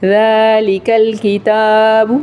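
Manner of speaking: slow "ذالک الکتاب" with long aa after ذ; measured recitation with held vowels in "ذالک الکتاب"; lengthened dhaal. Shown as a demonstration. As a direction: stretch "ذالک", then the taa of "الکتاب". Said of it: "ذال کو ہر حال میں تھوڑا سا لمبا".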